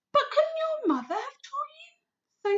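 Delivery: put-on high, hushed girl's voice; little girl whisper. Sophie voice in read story.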